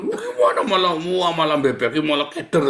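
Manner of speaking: loud, high flustered babble; mock panic. People told of trouble only flapping, panicked. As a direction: imitate a rescuer losing their head. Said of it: "malah panik".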